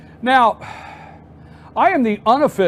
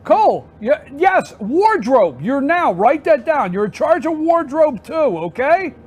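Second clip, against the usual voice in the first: much higher, playful mock complaint at the crew; mock exasperation; 8 semitones higher than usual. Real, not acted. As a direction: needle your producer in a high, exasperated voice. Teasing Cole about wardrobe.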